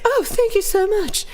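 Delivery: high, gushing thanks; hammed-up excited gratitude; imitating excited guy. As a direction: mimic a delighted stranger. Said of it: "he was all excited".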